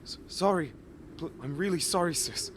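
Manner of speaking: high, breathless, stuttering sorry; stammered apology. Acted. Boy apologizing to his sister after the beating, frightened. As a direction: plead for mercy.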